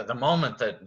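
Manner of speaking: ordinary talk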